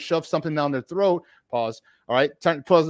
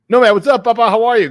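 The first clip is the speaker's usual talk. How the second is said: excited greeting; 5 semitones higher, 8 dB louder than usual. Real, not acted. Greeting co-host loudly on stream.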